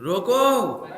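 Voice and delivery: "रोको" loud and high, closed off as its own phrase; standalone command रोको. Shown as a demonstration. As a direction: call out "रोको" with a full stop after. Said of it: "एक comma बदलने से opposite हो गया अर्थ". ("रोको" ऊँचे, तेज़ स्वर में, अलग से)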